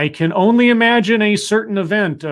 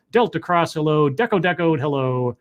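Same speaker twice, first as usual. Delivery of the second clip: usual speaking voice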